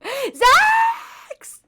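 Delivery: thrilled to see her friend; excited squeal. Real, not acted. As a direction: gasp then high loud cry of a name. Gasping, greeting a friend.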